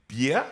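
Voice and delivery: "Beer" said bee-ah, rising; Jamaican pronunciation. Shown as a demonstration. Host coaching co-host to say "Beer" as Jamaican bee-ah, rising. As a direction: offer "Beer" as bee-ah for repeating. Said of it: "And you say".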